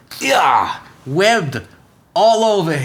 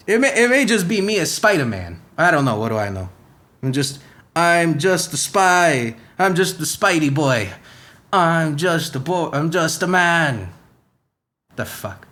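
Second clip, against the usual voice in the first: put-on Spider-Man accent. Spider-Man musing to the listeners in a lightly put-on accent. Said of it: "Sometimes the accent shifts".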